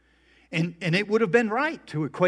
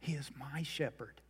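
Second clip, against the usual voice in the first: "He is my shepherd" whispered, much softer and intimate; personal whisper; 12 dB quieter than usual. Shown as a demonstration. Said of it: "David makes it personal".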